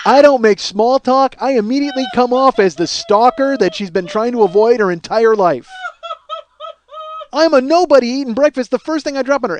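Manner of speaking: loud, high comic rant; worked-up, hammed-up self-disgust; exasperated self-berating. Comic rant against himself.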